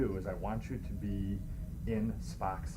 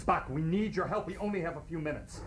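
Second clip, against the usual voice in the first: higher, urgent plea; urgent Kirk; 8 semitones higher than usual. Acted. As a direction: press him for help, urgent. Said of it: "I'm gonna do Kirk".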